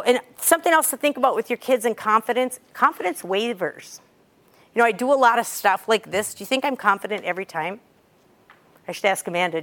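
ordinary talk

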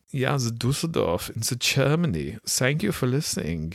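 put-on high, playful German-accented thanks; mock German accent.

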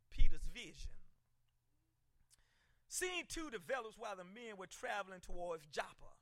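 usual speaking voice

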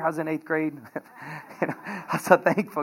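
ordinary talk, nothing marked

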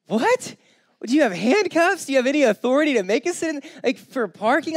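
high, loud mock disbelief; exaggerated what-are-you-doing voice; incredulous. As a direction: squeal it like you can't believe it. Mocking the Karen incredulously.

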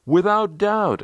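high cheerful call from the group. A narrator's reading. Team members answering newcomer in chorus, calling out high. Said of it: "they called out".

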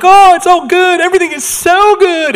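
sing-song fake churchgoer cheer, all smiles; fake happy. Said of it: "we fake happy sometimes".

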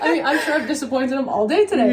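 amused self-deprecating laugh. Real, not acted. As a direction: laugh it off, fast. Laughing aside after amen.

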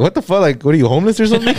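high, fast, laughing disbelief; shocked blurt. Retold or imitated.

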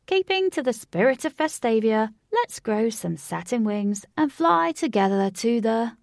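crooner working the crowd; showman announcer. A narrator's reading. Singer on the microphone to the crowd, showman style.

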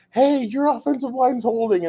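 high, mocking heckle; trash-talking fan. Philly fan trash talking the visitors, high mock heckle. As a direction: jab at the Chargers fan in a put-on voice. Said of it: "he'd be like".

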